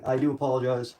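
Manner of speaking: usual speaking voice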